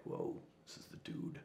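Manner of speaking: hushed, breathy whoa; awed whisper. Retold or imitated. Whispered to himself on first seeing the inmate. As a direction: whisper it to yourself in awe.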